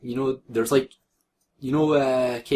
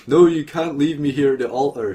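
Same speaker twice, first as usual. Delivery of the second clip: half-hearted shout; 4 semitones higher, 6 dB louder than usual. A narrator's reading. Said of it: "shouted Hiei in upset".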